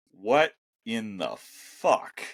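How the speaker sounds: very slow, word-by-word swearing; slow, emphatic exasperation; exasperated disbelief. Real, not acted. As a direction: draw out each word in disbelief. Exasperated rant about previous coaching staff.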